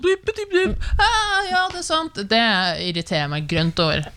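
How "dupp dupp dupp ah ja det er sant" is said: very high, bouncy Kristiansand caricature; hammed-up Kristiansand impression; mock Kristiansand dialect. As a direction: send up Kristiansand speech in a silly high voice. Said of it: "de fra Kristiansand, de er sånn".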